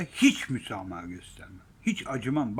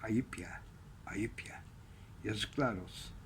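disgusted mutter; 5 semitones lower, 13 dB quieter than usual. Real, not acted. Disgusted aside after outburst.

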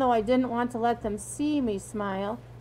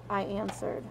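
ordinary delivery

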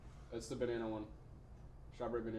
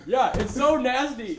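disgusted; 11 semitones higher, 10 dB louder, slower than usual. Real, not acted. After tasting spoiled milk bean.

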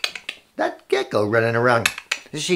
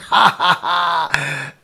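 hammed-up ha-ha laugh; acted crowd laughter; much slower than usual. Everyone laughs and points at the fight, acted ha-ha. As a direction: laugh like a mocking onlooker. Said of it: "everyone was laughing and pointing".

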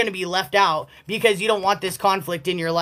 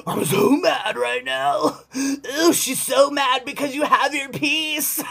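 mock sulking; 5 semitones higher, slower than usual. Mocking the woman's sulking.

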